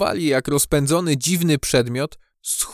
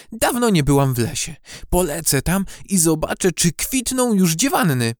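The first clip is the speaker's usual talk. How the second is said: higher, eager character voice; eager young bee; 5 semitones higher than usual. A narrator's reading. Maja decides to fly to the woods, eager. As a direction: plan an outing with lively eagerness.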